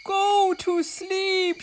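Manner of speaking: high, drawn-out slow shout. A narrator's reading. Toad remembers his last item, high slow shout. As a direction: shout it with sudden delight. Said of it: "shouted Toad".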